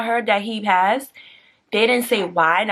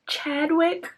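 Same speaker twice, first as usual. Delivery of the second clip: stunned; 10 semitones higher than usual. Real, not acted. Naming shocking death.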